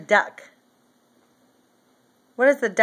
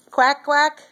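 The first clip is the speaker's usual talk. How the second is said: duck quack; 5 semitones higher than usual. Shown as a demonstration. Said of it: "And what does a duck say?".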